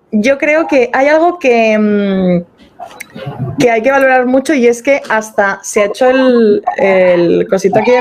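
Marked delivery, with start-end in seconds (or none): none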